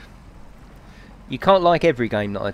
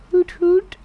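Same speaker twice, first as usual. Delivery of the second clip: high falsetto hoot hoot; owl impression; 4 dB louder than usual. Streamer reading chat's owl impression aloud, hooting high. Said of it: "do your impression in words of an owl".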